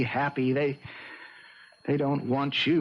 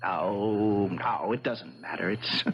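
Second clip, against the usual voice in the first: low, deflated, giving up; weary resignation; 8 semitones lower, slower than usual. Acted. Husband gives up arguing with wife, wearily resigned. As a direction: let the anger drain out, low and tired.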